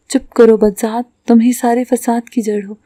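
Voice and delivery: ordinary delivery, nothing marked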